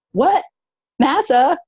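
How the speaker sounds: high, incredulous questioning; acted surprise. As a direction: re-enact being amazed at the news. Acting out astonishment at the job.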